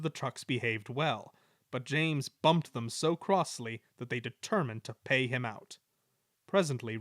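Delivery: ordinary delivery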